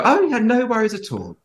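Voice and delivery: high, sweet mock reassurance; falsetto-sweet airline agent; sugary reassurance. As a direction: sing it up high, all false comfort. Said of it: "And I'd go".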